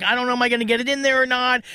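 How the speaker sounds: retold nervousness. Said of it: "I was all nervous about it".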